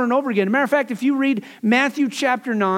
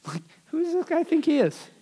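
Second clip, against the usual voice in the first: high, softer indignant grumble; mock indignation; 7 semitones higher, 4 dB quieter than usual. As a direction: play an offended sceptic questioning someone's nerve. Voicing sceptical teachers' indignant thoughts.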